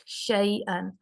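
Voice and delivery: شین stretched very slowly with breath filling the mouth; spread shin. Shown as a demonstration. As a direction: let the air spread through the whole mouth on شین. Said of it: "تفشی". (شین کو سانس پھیلا کر لمبا ادا کرنا)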